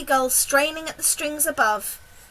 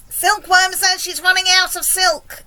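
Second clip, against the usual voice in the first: loud, higher-pitched report; yelled; 5 semitones higher, 7 dB louder than usual. A narrator's reading. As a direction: yell the bad news up from below. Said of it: "yelled the centipede from below".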